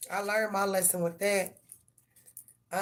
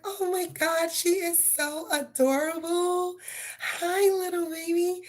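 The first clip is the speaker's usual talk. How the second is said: high-pitched gushing stranger voice; 9 semitones higher than usual. Retold or imitated. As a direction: coo over the baby way up high, drawn out.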